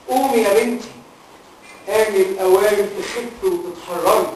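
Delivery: quiet, slow, otherworldly command; solemn saint. Acted. The saint's spirit quietly tells the girl to rise, slow and solemn. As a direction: speak as a calm holy presence.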